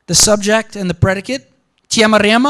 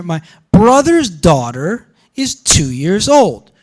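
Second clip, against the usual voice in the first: put-on slow, louder, with weight on BROTHER'S, DAUGHTER, TWO and OLD; pitch accents emphasised; 5 dB louder, slower than usual. Shown as a demonstration. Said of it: "one of the things that we're seeing here".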